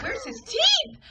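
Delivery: high, shocked squeal; shocked blurt. Retold or imitated. At the hospital bedside, a high shocked squeal. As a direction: squeal it in disbelief. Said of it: "And then I said".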